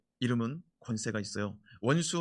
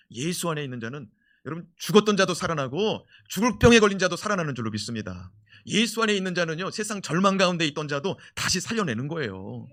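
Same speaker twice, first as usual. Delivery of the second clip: fiery preaching; 2 semitones higher, 5 dB louder than usual. Acted. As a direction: drive it home to the church. Preaching at full force. (열정적인 설교 톤)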